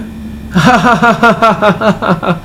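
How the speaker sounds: long, high staccato mock laugh; acted laughter. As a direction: imitate a helpless, forced laugh in a long run of ha-ha-ha. Acts out grinning child's forced laugh.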